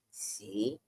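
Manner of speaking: very low, soft 'See?'; hushed told-you-so. Retold or imitated. Tony lying down at night, a low hushed told-you-so. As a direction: murmur it low, making the point. Roommate's pointed word from bed.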